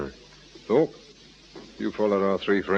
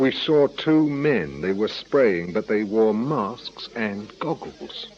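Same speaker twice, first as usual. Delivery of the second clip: slow deliberate; slower than usual. Acted. Detective laying out the deduction slowly.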